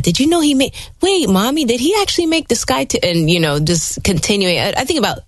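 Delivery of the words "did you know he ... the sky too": put-on high, quick little-kid voice; child's question. Mom imitating her child's excited question to her. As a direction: mimic your child asking in wonder.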